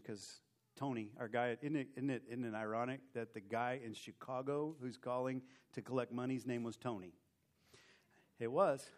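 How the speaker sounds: ordinary talk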